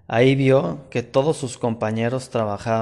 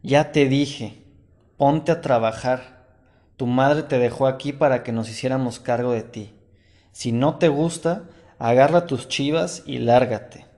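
ordinary delivery

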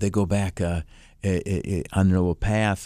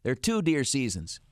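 higher, patient fatherly tone; dad's voice; 7 semitones higher than usual. Retold or imitated. Father explains hunting seasons to his son, patient. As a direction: explain it like a dad who knows better. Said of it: "and he goes".

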